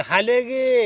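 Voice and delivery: loud drawn-out call across the field; calling out to cattle. Real, not acted. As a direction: holler to the cow far off. Calling the cow again. (गुरांना मोठ्याने हाक)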